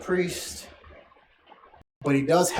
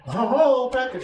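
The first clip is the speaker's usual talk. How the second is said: excited about package; 8 semitones higher, 7 dB louder, much slower than usual. Real, not acted. Spotting a package arrive.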